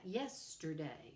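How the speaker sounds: "yesterday" slowly, stress on YES; stress on first syllable. Shown as a demonstration. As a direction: lean on the "yes" that opens "yesterday". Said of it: "with a stress on the first syllables".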